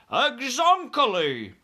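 high, loud, drawn-out exclamation; BFG crying out. A narrator's reading. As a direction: cry out in agreement as the giant. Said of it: "cried the B F G".

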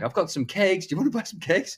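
put-on little kid selling cakes; imitating a little kid. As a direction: pitch the cakes like an eager child. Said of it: "They're like".

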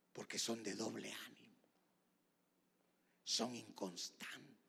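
ordinary talk

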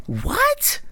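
squeaky high disbelief; shocked squeak of disbelief; incredulous what. Real, not acted. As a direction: scoff at the silly idea. Baffled by own quote.

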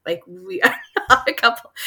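laughing through a flustered denial. Retold or imitated. Retelling the temple worker moment, laughing through the denial. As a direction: giggle it out, half embarrassed. Denying being a couple, laughing.

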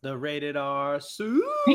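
hammed-up announcer call; mock ring announcer. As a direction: stretch the last word like a wrestler's introduction. Mock announcing a wrestler's nickname.

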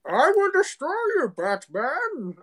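muffled villain talking through a mask; Tom Hardy mask voice. Retold or imitated. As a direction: growl it out like a masked brute. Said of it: "that's 'cause it's Tom Hardy in a mask".

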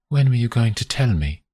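plain reading voice

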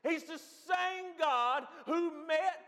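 high, loud pulpit proclamation; full-force preaching. Real, not acted. Preacher insisting to the congregation God never changed, full-force preaching. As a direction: preach at full force, stretching the key phrases.

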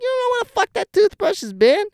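high, loud alarmed yell; fiancée yelling. Retold or imitated. Fiancée stopping him from grabbing the toothbrush, loud high yell. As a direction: shout him to a stop in a high voice. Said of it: "She's like".

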